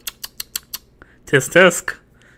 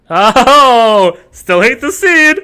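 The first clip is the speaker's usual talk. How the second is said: ordinary delivery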